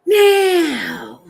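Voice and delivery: a loud drawn-out high "now"; loud, high, thrilled "now"; excited. Real, not acted. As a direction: burst out with excitement. Excited outburst.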